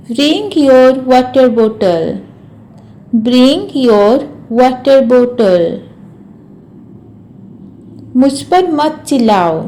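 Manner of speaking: ordinary delivery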